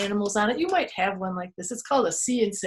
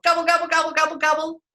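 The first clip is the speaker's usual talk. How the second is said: high-pitched turkey gobble run; bird-like rapid gobbles; turkey gobbling; 6 semitones higher, 3 dB louder, much faster than usual. Shown as a demonstration. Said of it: "When we talk about a turkey, we go".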